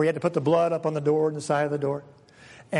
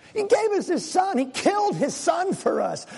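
soaring exclamation; 12 semitones higher than usual. Real, not acted. Preacher exclaiming.

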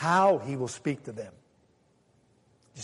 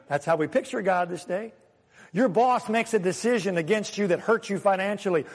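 ordinary talk

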